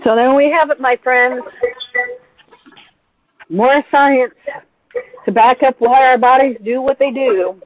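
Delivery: bright, lifted wrap-up; cheerful host closing; upbeat sign-off. Real, not acted.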